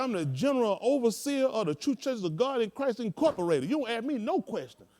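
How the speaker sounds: high, loud, laughing mock-pompous overseer; pompous bishop. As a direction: puff up like the self-important overseer. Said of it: "He said".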